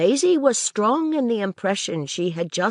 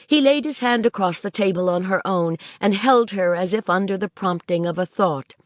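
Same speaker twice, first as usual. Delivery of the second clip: ordinary delivery, nothing marked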